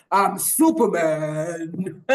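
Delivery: low, slow heroic announcement; Superman. Shown as a demonstration. Superhero comes into a room, announcing himself grandly. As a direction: make a grand, drawn-out superhero entrance. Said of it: "when a superhero comes into a room".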